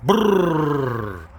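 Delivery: shivering brrr sound. Real, not acted. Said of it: "It's freezing".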